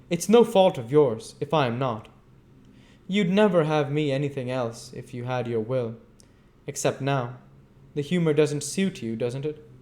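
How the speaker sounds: bitter. A narrator's reading. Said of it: "cried the girl bitterly".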